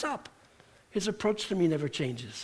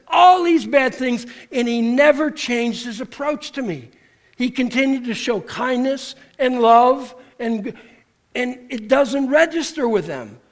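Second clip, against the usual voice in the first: loud retold confession; 5 semitones higher, 7 dB louder than usual. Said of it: "that says".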